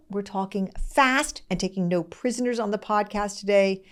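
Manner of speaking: ordinary talk, nothing marked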